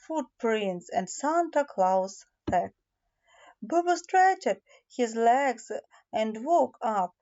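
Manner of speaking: plain reading voice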